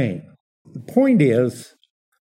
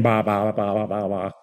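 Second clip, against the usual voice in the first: lower, softer "Ba, ba, ba" babbling; mock barbarian babble; 5 semitones lower, 3 dB quieter, faster than usual. Preacher mimics barbarians as Greeks heard them, lower mock babble. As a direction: lightly imitate foreigners who can't speak Greek. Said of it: "they hear the barbarians saying".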